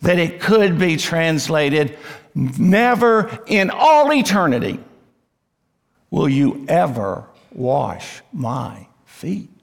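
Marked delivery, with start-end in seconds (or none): 2.3-4.8 "Never" and "all eternity" punched high; emphatic never. Acted. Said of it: "this is so emphatic". 6.1-9.5 slow, separated words with weight on each; emphatic, word by word. Acted. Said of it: "this is so emphatic".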